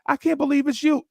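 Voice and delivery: high-pitched mock overwhelmed child; tearful starstruck kid. Imitating the crying kid answering his idol, high and overwhelmed. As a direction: cry it out as an awestruck little boy. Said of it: "see the kid crying like".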